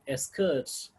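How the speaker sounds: normal talking voice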